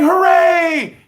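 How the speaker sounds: high, shouted fake hooray; mock cheer. Host mimicking fans celebrating an ugly win, shouted mock cheer. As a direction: cheer it like a gullible fan. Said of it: "You might be saying".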